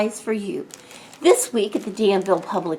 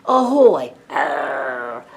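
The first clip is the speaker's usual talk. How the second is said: pirate calls; 8 semitones lower, much slower than usual. Pretend. Host reading sticker words in a playful pirate voice. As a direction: play the pirate for the kids.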